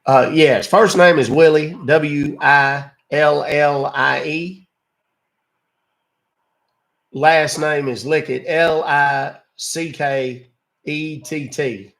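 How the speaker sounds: loud put-on Southern drawl; hammed-up Southern customer, letters drawn out; folksy Southern caller. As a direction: spell it out slowly as a Southern gentleman. Prank caller spells fake name.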